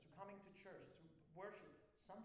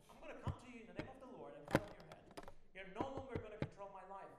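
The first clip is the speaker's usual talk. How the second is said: louder, fast, fervent defiance; bold declaration; 2 semitones higher, 7 dB louder than usual. Shown as a demonstration. Believer tells his problem it has no more control, loudly. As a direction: preach the challenge, driving forward. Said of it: "imagine talking to your problems like this".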